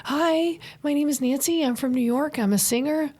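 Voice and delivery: high, eager self-introduction; acted-out hopeful singer at the door; retold chirpy pitch. Acts out her door-to-door pitch.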